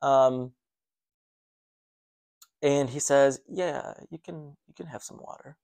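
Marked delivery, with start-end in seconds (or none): 3.5-5.6 creepy stranger. Retold or imitated. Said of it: "Creepily said it".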